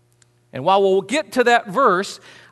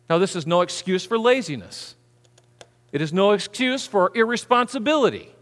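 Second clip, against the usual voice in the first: usual speaking voice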